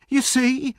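very high, triumphant appeal; cried out. A narrator's reading. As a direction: cry it out to the onlookers. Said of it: "cried Nancy".

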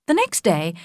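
plain reading voice

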